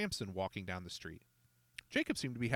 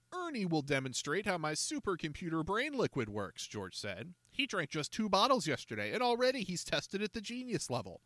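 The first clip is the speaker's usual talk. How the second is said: higher, louder sales pitch to a crowd; showman salesman George; 7 semitones higher, 4 dB louder than usual. A narrator's reading. George pitching to the younger kids, a showy barker. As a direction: hawk it like a carnival barker.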